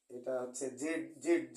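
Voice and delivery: জেড said loud and slow, as the wrong way; wrong zed. Shown as a demonstration. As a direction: stress জেড as the outdated form. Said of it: "জেড বলছে না". (জোর দিয়ে জেড)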